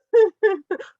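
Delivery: sudden high, loud laugh at herself; burst of laughter. Real, not acted. Laughs at being a serial mom.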